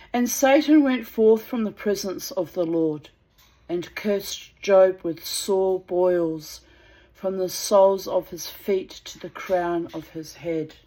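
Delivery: slow, loud, solemn Bible reading; scripture reading. Acted. Reading the Book of Job aloud to viewers, solemn. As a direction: intone it like a sermon.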